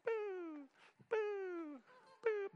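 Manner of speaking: squeaky falsetto boo as the Cheerio flies; a goofy high-pitched boo sound; high silly boo. Retold or imitated. Playing the flying Cheerio in a squeaky boo.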